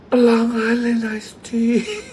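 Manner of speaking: high, slow, delighted repeat of the order; thrilled gasp. Retold or imitated. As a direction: savour the words like a dream come true.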